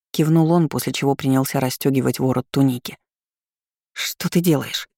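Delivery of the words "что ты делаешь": gasped question. A narrator's reading. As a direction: catch your breath on it. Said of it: "ахнула я".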